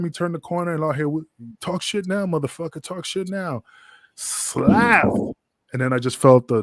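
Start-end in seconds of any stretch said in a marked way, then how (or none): 1.6-3.6 menacing threat. Retold or imitated. Jack's threat during sneak attack. 4.1-5.0 sharp unvoiced slap noise; slap sound.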